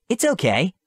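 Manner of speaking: high, gentle reassurance; calm a frightened little creature; soothing. Acted.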